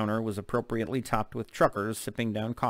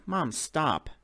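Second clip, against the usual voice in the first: annoyed; 5 semitones higher than usual. Acted. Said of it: "said in an annoyed tone".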